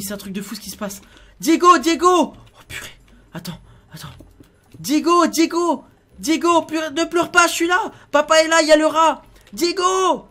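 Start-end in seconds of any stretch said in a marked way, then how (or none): 1.4-2.3 loud, high shouted name; calling out. Pretend. Calling his trapped son. 4.8-10.3 put-on loud, high, urgent calls to a child; calling out. Calls to his crying son.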